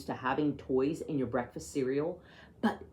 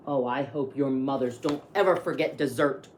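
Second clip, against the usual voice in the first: ordinary delivery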